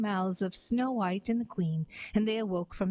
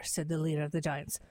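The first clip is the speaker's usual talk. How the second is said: ordinary delivery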